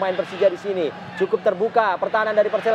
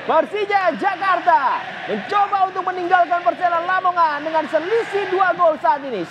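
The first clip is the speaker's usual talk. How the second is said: excited commentary; 8 semitones higher than usual. Real, not acted. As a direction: keep the goal buzz going. After the penalty goal.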